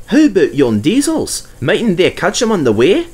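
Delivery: a Scottish burr for Douglas; gruff Scottish engine talking. Acted. Douglas answering Percy in a Scottish voice.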